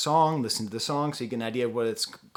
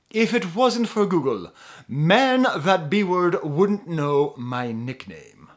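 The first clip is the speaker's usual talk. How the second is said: higher, louder, slow plummy British English read of rap lyrics; posh British accent; 3 semitones higher, 8 dB louder, much slower than usual. Pretend. Reviewer reads [name]'s lyrics to viewers in a mock posh British accent. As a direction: recite the trap lyrics like an upper-class Brit, slowly and grandly. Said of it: "I'm going to read this with a posh British accent".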